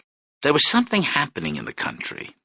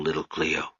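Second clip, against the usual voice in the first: "little Cleo" softer and lower; 5 semitones lower, 9 dB quieter than usual. A narrator's reading. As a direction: say "little Cleo" gently, almost hushed. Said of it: "Marius's voice was soft".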